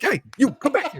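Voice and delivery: hammed-up Dante calling back the customer; mock calling out. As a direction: shout after a man walking away. Dante calling after the man.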